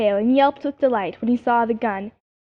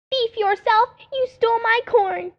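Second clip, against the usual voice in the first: squirrel screaming back; 11 semitones higher than usual. Acted. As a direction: shriek the accusation back. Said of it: "he screamed back".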